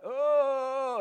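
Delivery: high, loud mock groan; hammed-up drawn-out moan of agony. Addict groaning from the back of the flat, imitated moan. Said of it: "I could hear groaning from the back of the flat".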